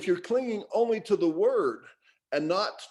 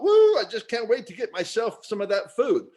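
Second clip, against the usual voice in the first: high, louder, laughing whoop; excited about food; 4 semitones higher, 5 dB louder than usual. Retold or imitated. Greedy worshipper whooping for food, laughing. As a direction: whoop like you can't wait to eat. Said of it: "They're totally excited about".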